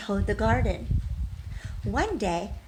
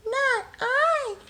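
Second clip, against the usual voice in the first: squeaky mouse. Acted. Mouse's refusal in the story.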